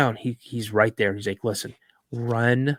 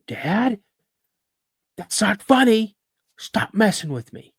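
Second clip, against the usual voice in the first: put-on higher, loud on FUNNY, upset kid complaint; indignant retold plea; acted protest to dad; 9 semitones higher, 4 dB louder, slower than usual. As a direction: call out to dad, fed up with a prank. Retelling his frightened childhood protest to dad.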